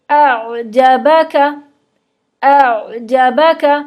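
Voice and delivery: अअजबका with the ain drawn on, very slow; flowing sukun ain. Shown as a demonstration. Teacher showing sukun ain, slow and flowing. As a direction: let the ain in अअजबका run on without a stop. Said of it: "आइन की आवाज़ थोड़ी सी चलती है".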